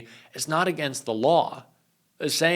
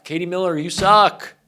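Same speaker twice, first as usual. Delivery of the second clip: higher, louder jab, hitting "suck"; exasperated outburst; 5 semitones higher, 5 dB louder than usual. Real, not acted. Ranting directly at Katie Miller, exasperated and loud. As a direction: throw the insult out harder. Second insult escalates the rant.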